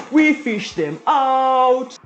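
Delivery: slow drawn-out announcement. Acted. Cooking show hype.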